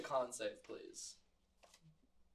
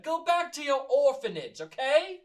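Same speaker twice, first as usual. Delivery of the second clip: scolding tavern mom; 10 semitones higher, 8 dB louder, slower than usual. Acted. Mama scolding Carter.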